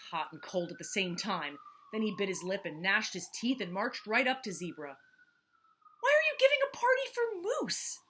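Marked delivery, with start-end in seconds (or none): 6.0-8.0 high, loud, fast demand; march up and demand it. Acted. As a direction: Jackal demanding an answer from Zebra, high and loud. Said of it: "he demanded".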